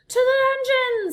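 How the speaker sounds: very high, loud jeering cry; mob shout. A narrator's reading. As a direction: call for the dungeon. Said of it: "said a mouse in the front row".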